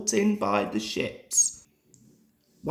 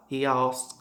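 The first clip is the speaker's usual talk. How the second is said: ordinary delivery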